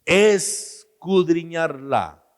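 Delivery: Escudriñarla drawn slowly and loudly; emphatic answer. Shown as a demonstration. Preacher answering his own question with slow emphasis. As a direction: lean hard on Escudriñarla. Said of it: "¿Qué debemos hacer entonces?".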